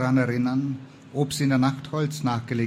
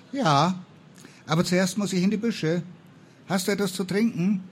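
lightly voiced higher, lively girl; a touch brisk, pitched up; lively higher character voice; Martha, lively companion; 6 semitones higher than usual. A narrator's reading. Martha's figure in story.

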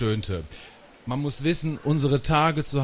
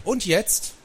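high, pointed question; eager, high challenge; challenging question; 10 semitones higher than usual. A narrator's reading. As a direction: ask it pitched well up, expectant. Boy challenges in card game.

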